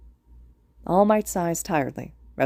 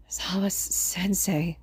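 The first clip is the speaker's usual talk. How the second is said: weak, slow, breathy whisper; 2 semitones higher, 4 dB quieter, much slower than usual. A narrator's reading. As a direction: rasp it out like a dying boy. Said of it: "The raspy, breathy voice".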